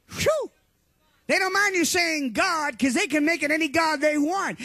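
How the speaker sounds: usual speaking voice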